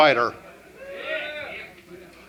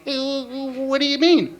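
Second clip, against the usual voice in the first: higher, childlike cartoon bear; cartoon Boo Boo voice; 7 semitones higher than usual. Kid to dad in a high cartoon voice. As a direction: squeak it like a cartoon cub. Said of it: "talk to each other in cartoon voices".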